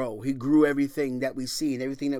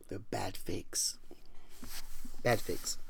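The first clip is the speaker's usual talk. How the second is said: whisper; 6 semitones lower, 10 dB quieter, slower than usual. Acted. Preacher whispers the verdict.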